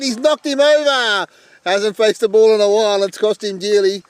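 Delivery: loud, high, laughing whoops of joy; roaring celebration in the commentary box; excited yelling. Real, not acted. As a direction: erupt as the wicket falls, yelling. Wicket falls, commentator erupts.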